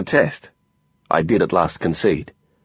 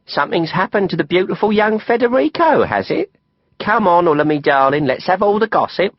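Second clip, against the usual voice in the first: high, gossipy London voice; Letty, teasing London friend; 9 semitones higher than usual. A narrator's reading. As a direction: coax out the gossip playfully.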